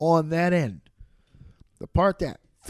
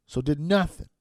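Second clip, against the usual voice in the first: ordinary talk, nothing marked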